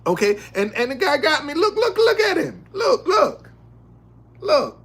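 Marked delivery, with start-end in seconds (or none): 1.5-4.8 excited pointing. Real, not acted.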